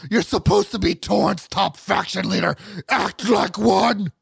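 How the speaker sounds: loud, high harsh demon shout; furious demonic berating, at full volume; demonic snap. Acted. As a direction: roar orders like an angry demon. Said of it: "snapped back in a demonic voice".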